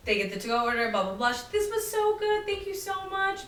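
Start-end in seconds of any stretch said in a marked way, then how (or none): 1.5-3.5 much higher, gushing thanks; mock gushing customer. Departing customer thanking the server, pitched high and gushing. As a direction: gush like an overly polite guest. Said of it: "customers thanking as they leave".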